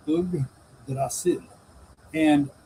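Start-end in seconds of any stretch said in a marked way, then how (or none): 0.1-1.4 Yggdrasil slowly, as 'oog ... dra-SIL'; broken into parts. Shown as a demonstration. Teacher showing a compound word, Yggdrasil said slowly in parts. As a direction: split Yggdrasil after a long 'oog', softly. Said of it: "compound word".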